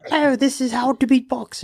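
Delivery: high-pitched British tutorial host impression; mock British accent. Mimicking a UK beatbox tutorial, mock British accent. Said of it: "trying to access my British accent".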